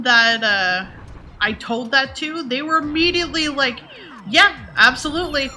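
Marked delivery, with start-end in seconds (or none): 4.3-5.5 quoted voice, higher and emphatic, fully agreeing; emphatic agreement. Retold or imitated. Friends confirming the streamer's Canadian mom voice, emphatic agreement. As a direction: imitate an instant, higher-pitched confirmation.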